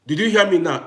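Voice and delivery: normal talking voice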